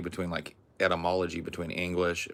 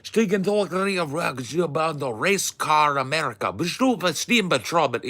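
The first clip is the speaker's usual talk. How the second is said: loud, high gibberish in a German accent; mock fluent German; 9 semitones higher, 6 dB louder than usual. Shown as a demonstration. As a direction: rattle out fake German at full voice. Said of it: "try and do the approximation of it".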